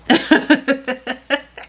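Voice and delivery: real amused laugh; high laugh. Real, not acted. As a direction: laugh at the absurd story. Laughs at fist fight story.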